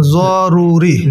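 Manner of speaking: জরুরি drawn out very slowly, Arabic z; teacher modelling Arabic জরুরি; very slow Arabic demo. Shown as a demonstration. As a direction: stretch জরুরি like the Arabic word. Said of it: "আরবিতে কি হচ্ছে".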